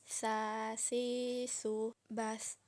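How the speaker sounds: "Sa, si, su, bas" with a soft th as in "think", breath flowing; long vowels on "Sa, si, su", tongue tip at the upper teeth; soft flowing tha. Shown as a demonstration. Teacher shows the letter tha, "Sa, si, su, bas" with soft airflow. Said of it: "mengalirkan suara dan nafas dengan lembut".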